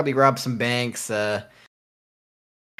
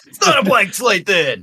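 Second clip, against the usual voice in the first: protest; 7 semitones higher, 7 dB louder than usual. Real, not acted. Laughing protest.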